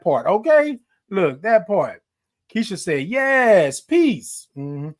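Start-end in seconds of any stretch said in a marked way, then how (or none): 3.1-4.5 raised, punched-up affirmation; emphatic reading. Real, not acted. Host voicing a viewer's typed comment, emphatic. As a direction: read the comment with relish, pitch lifting on the last word. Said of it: "I'm gonna say it like that".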